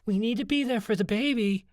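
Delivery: high-pitched earnest appeal; plead, pitched well up; urgent plea. A narrator's reading. Opal urges the fighting group to support the baby, pleading.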